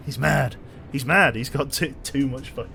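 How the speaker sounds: loud, amazed cry of disbelief; excited. Real, not acted. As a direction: shout it out, stunned by the donation. Excited reaction to big donation.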